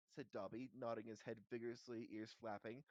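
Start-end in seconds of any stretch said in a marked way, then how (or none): none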